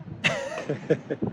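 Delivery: sudden high squeaky laugh; high burst of laughter. Real, not acted. Laughing at Sweden renaming the park to annoy Russia. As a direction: burst out laughing at the absurd name.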